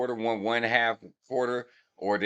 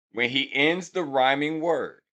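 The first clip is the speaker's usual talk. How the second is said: ordinary talk, nothing marked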